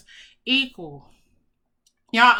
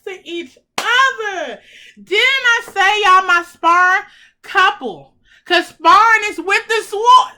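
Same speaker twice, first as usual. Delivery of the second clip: excited; 7 semitones higher, 8 dB louder than usual. Real, not acted. Spirited reveal of matching cards.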